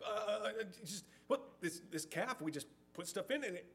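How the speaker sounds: high-pitched, blubbering excuse-maker; hammed-up flustered stutter; Aaron. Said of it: "he's just kind of blubbering. He's stumbling over himself".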